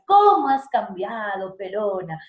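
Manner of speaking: "¿Cómo has cambiado, pelona?" loud drawn-out start, falling away on PELONA; descending intonation. Shown as a demonstration. Teacher acting out a classic phrase, descending tune. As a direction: start strong on CÓMO and sink gradually. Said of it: "Inicias y luego vas descendiendo".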